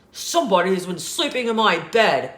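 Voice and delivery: loud, fast, growly bear voice; Papa Bear's loud growl. A narrator's reading. Papa Bear finds his pillow moved, loud growl. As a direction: growl it out loudly like a big bear. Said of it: "in his loud, growly voice".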